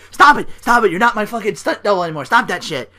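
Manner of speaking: high loud angry yell. Retold or imitated. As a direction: scream at the copycat to stop. Miz yelling at Mizdow.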